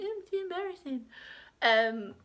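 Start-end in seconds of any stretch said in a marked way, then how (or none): 0.0-1.0 embarrassed niece. Retold or imitated. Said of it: "she's gonna be".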